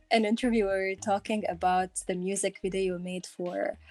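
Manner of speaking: normal talking voice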